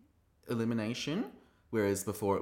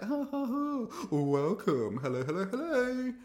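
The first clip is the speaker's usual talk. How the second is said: much higher whooping host laugh into sing-out welcome; Rue impression; 14 semitones higher, 3 dB louder than usual. Host welcoming the queens, high hammy impression. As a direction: greet them in a high, hammy hostess voice. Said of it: "Rue's like".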